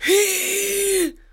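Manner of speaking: very high, long "hiii" cry; storyteller's held, high bird screech; owlet's screech. A narrator's reading. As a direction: imitate a little owl shrieking. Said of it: "Dann schrie es".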